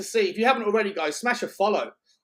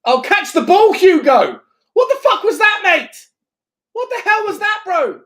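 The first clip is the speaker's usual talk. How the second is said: furious shout, much higher and louder; yelling from the sidelines; 14 semitones higher, 11 dB louder than usual. Real, not acted. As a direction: scream at the keeper in disbelief. Yelling at goalkeeper.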